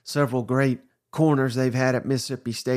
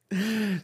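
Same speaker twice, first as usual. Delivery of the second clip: short disbelieving laugh; 7 semitones higher, 4 dB quieter than usual. Real, not acted. Laughs at the news.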